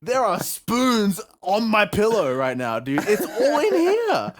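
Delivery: high, loud disbelief with laughter breaking in; comically worked-up shouting, pitch climbing; exasperated yelling. Retold or imitated. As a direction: retell it as a rising, incredulous rant at mom. Acted exasperated plea to his mother.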